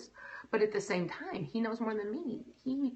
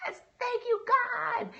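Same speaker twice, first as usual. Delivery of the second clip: much higher, bursting thanks; joyful cry; slower than usual. Retold or imitated. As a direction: let the relief shoot up high. Overjoyed at husband's job loss.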